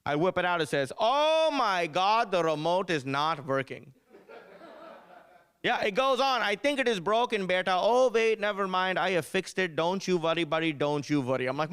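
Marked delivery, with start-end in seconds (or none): 1.0-3.9 mock Indian-accented text, drawn out slowly; slow, exclaiming Indian-accent mom. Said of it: "have Indian accents". 6.4-11.5 mock Indian-accented mom reading; higher-pitched, reassuring Indian-accent impression. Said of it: "have Indian accents".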